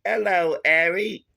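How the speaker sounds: loud, high, hammed-up upper-class English; mock posh English. Mocking an actress's posh English greeting, loud and high. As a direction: ham up a bad posh English lady. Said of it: "a woman from the upper class".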